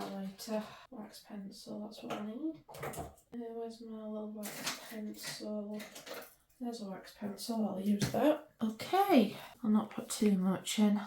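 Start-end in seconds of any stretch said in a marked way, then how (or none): none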